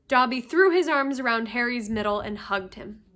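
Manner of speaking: ordinary delivery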